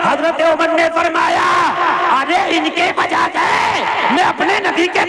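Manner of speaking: high, loud sermon cry of equality; fiery preaching. Acted. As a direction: proclaim it to the whole crowd at full force. Preaching at full force.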